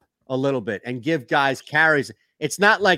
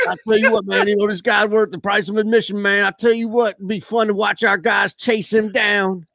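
higher-pitched twangy coach impression; Gruden twang; 6 semitones higher than usual. Second try at the coach's quote, full twang. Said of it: "I want the full twang".

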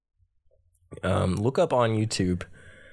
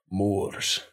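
slower, low Scottish lilt; deep Scottish accent. Shown as a demonstration. Showing co-host a favorite accent, deep Scottish. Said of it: "the deeper, slower one".

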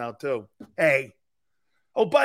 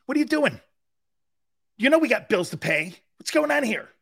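imitating his wife; 6 semitones higher than usual. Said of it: "She's like".

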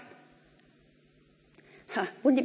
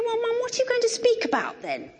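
put-on teenage daughter; 13 semitones higher than usual. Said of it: "She said to me".

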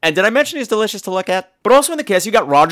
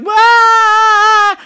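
long, high, loud sustained wail; mock wail; 6 dB louder than usual. Maggie with the ring stuck on, mock wailing. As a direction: hold a hammed-up high cry. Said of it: "And she's like".

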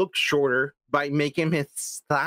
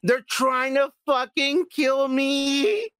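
imitating the Joker; 9 semitones higher, slower than usual. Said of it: "He's like".

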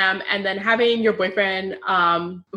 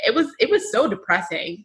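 normal talking voice

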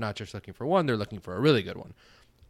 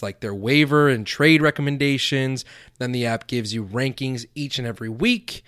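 loud, punchy sponsor pitch; ad read; 3 semitones higher, 7 dB louder than usual. Acted. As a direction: hype the app to the listeners.

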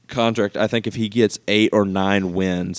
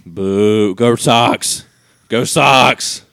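boo, then a louder team chant; rival-fan cheering; 2 semitones higher, 6 dB louder, much slower than usual. Pretend. Host answers Yankees talk as a Sox fan, cheering. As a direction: call out like a fan in the stands.

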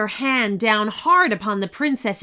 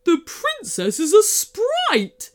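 shocked gasp, slow and higher; gasping surprise; 12 semitones higher, 5 dB louder than usual. A narrator's reading. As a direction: gasp out the discovery. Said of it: "gasped the griffin".

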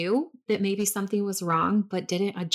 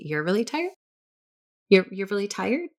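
incredulous; 3 semitones higher than usual. Retold or imitated. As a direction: throw his "really tired" back at him in disbelief. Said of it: "just being so angry inside".

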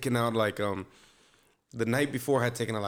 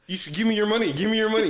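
mock demand; 7 semitones higher, faster than usual. Retold demanding cash from friend.

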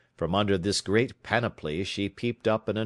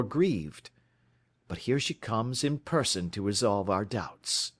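a lightly voiced Holmes, higher and assured; 6 semitones higher than usual. A narrator's reading. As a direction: read Holmes as the cool, knowing detective. Narrator voices Holmes deducing.

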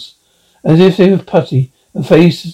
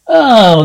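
startled cry; 6 semitones higher, 3 dB louder than usual. Acted. Clarence wakes in horror.

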